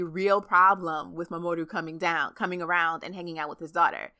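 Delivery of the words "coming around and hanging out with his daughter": ordinary delivery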